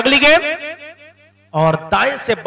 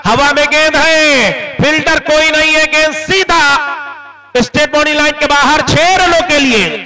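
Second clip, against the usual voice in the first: excited cricket commentary; 3 semitones higher than usual. Real, not acted. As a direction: ride the shot to the rope. Ball in air for six.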